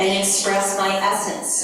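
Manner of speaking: slow, deliberate; slow call for the group to repeat; slow lead line. Shown as a demonstration. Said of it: "say it together now".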